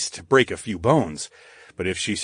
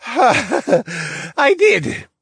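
giggling; 4 dB louder than usual. A narrator's reading. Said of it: "the blonde man giggled".

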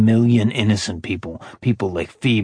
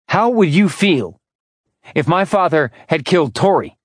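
furious challenge; 7 semitones higher than usual. A narrator's reading. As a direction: demand an answer in anger. Orion furious challenge to Ash.

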